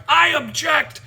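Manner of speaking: high, loud defiant interjection; imitated courtroom outburst; mock outraged objection. Defendant interrupts judge again, acted.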